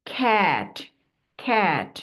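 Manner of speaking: খ্যাট said with short open a; cat word demo. Shown as a demonstration. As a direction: blend to খ্যাট, clipped at the end. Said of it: "তাহলে কি হলো?".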